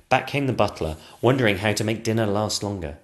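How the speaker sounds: ordinary delivery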